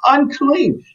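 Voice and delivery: yelling out. Retold or imitated. Leper warning the village before coming near, rising cry. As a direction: call out a warning to keep people away. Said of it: "they would have to yell out".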